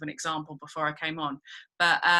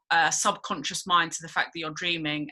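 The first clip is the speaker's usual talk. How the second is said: ordinary talk, nothing marked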